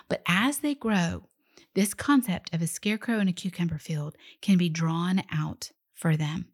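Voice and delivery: usual speaking voice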